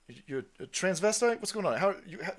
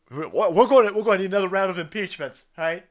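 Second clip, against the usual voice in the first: ordinary talk, nothing marked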